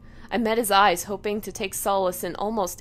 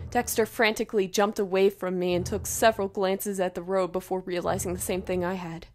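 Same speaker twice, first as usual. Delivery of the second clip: usual speaking voice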